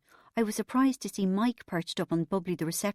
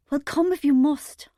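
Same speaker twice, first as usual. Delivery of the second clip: sighed; 8 semitones higher, 8 dB louder than usual. A narrator's reading. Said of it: "I sighed".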